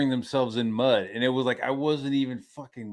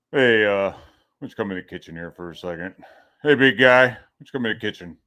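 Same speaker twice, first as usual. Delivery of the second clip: lightly voiced dad, pitched lower; imitating dad; 6 semitones lower, 6 dB louder than usual. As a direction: casually summon your kid in a low dad voice. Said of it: "my dad would, like, like, I'd do something".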